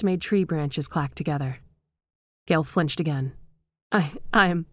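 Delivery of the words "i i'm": a touch of a halting stammer. A narrator's reading. Frightened daughter before her father's ghost, stammering. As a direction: catch on the first words. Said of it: "she stammered".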